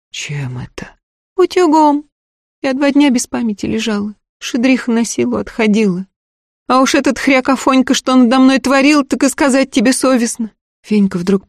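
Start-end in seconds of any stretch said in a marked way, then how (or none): 0.1-1.0 quiet shocked whisper; hushed whisper. Acted. Man asks, seeing scars. 1.4-10.5 put-on high, eager young servant girl; peasant girl voice. Girl tells of abuse.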